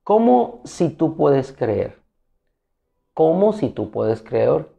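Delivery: cómo stressed, slow questioning read; a slow, doubting echo that leans on "cómo". Shown as a demonstration. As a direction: lean on "cómo" and draw out "¿Cómo si tú puedes creer?" slowly. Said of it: "Bastardilla, ¿cómo?".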